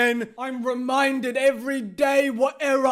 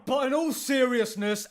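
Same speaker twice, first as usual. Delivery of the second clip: normal talking voice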